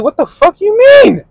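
high, loud squeal of disbelief; yelling back at her in a high mock shriek; mock outraged. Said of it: "And I'm like".

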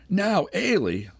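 high, slow indignant echo of "Now, Ailey?"; indignant cry. A narrator's reading. Ailey flinging Andy's words back at him, high indignant cry. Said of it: "she cried".

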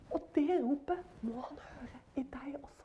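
hushed whisper. Real, not acted. Preacher whispering to the listener. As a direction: lean in and whisper it.

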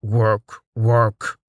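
a touch of orc grunting. Retold or imitated. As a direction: do your best orc voice. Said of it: "in his best orc voice".

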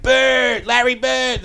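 "Bird" stretched long, R dropped, called out; Boston accent. Shown as a demonstration. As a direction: shout "Larry Bird" like a Boston fan. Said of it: "we'll take that a little long".

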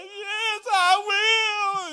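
very high, crying mock yes; husband voicing his bride's weepy acceptance; imitating tearful yes. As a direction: squeak out a tearful happy yes. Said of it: "And she said".

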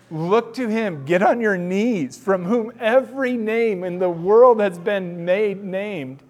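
ordinary talk, nothing marked

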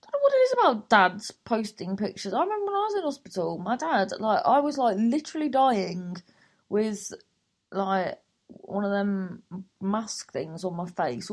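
normal talking voice

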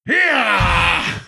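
sharp high battle yell; a short, high tennis grunt. Retold or imitated. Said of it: "Can she attack or fight without going".

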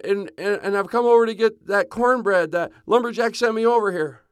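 loud high calls across the yard; calling out. Acted. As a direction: shout for Sebastian from outside. Joey calling out at house.

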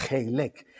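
Heilek with a throaty guttural H; guttural pronunciation. Shown as a demonstration. Preacher teaches the congregation a guttural Heilek. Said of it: "así, gutural".

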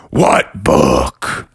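low, menacing guardian demand; scary voice. Acted. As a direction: drop deep and demand an answer. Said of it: "your voice is pretty scary".

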